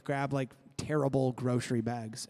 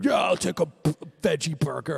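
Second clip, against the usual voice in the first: construction guy voice; 4 dB louder than usual. Pretend. Joins the construction guy bit.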